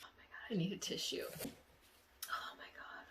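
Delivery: breathy tearful whisper, trailing away. Real, not acted. Needing a tissue while recounting the dream, tearful whisper. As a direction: voice nearly gone from tearing up.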